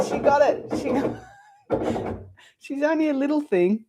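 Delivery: teacher delighted the assistant managed it, laughing high. Real, not acted.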